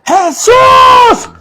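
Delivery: a blind beggar crying out over the crowd; desperate shout. Retold or imitated. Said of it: "él gritaba".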